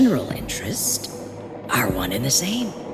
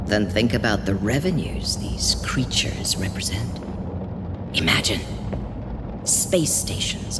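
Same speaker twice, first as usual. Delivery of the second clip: low, tempting whisper; seductive whisper; 4 semitones lower, 5 dB quieter than usual. Acted. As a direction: lure her in softly. Tempting Maiko with profits.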